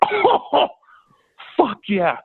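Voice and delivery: very high joyful whoop; thrilled cheer. Retold or imitated. Thrilled at call-up.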